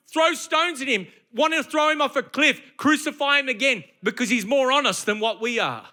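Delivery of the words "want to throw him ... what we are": ordinary talk, nothing marked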